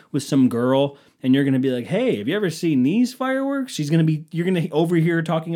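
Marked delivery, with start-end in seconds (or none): 1.9-3.7 lightly acted, high-pitched chat-up line; put-on higher voice to impress someone; mock party pickup. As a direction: pipe up with an overeager opener.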